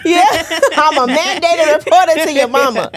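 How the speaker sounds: high, loud and laughing; laughing mock warning. Cool aunt warns the kids she tells mama, laughing mock warning. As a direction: crack up while playing the tattling aunt.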